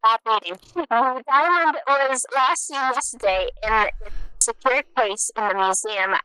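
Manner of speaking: mock news anchor reading. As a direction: read it like a radio bulletin. Reads a made-up news bulletin.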